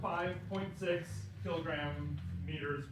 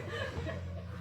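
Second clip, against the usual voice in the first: airy giggle. Real, not acted. Short giggle.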